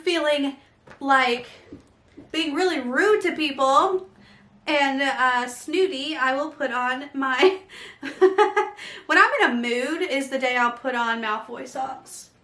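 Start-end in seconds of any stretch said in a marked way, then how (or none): none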